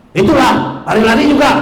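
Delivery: a sharp told-you-so; scolding reproach. Retold or imitated. Parent scolding a child who fell, told-you-so tone.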